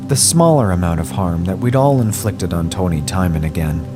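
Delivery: ordinary delivery, nothing marked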